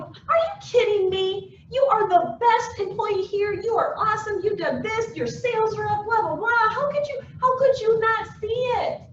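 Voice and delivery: high-pitched, disbelieving pep talk; incredulous encouragement. Acted. Boss telling Anna she is the best, incredulous. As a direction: gush in amazement, pitch way up.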